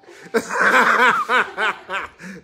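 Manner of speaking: big high burst of laughter; loud real laughter. Real, not acted. Preacher laughing at people who expect peace while raging, loud. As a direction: crack up at the absurd idea.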